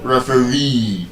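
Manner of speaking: slow, soft "referee" with long REE; "referee" as ref-uh-REE, ending drawn out; stress on last syllable. Shown as a demonstration. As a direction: stretch the final syllable of "referee". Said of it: "The stress is on the last syllable".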